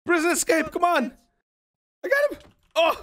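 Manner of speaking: high, excited gamer cries; frantic, high-pitched calling out; urgent alarm. Real, not acted. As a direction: sound the alarm mid-chase. Calling alarm at escaping prisoners in game.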